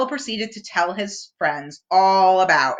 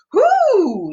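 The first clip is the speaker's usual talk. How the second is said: Owl voice; 12 semitones higher, 7 dB louder than usual. A narrator's reading. Owl voiced in read story.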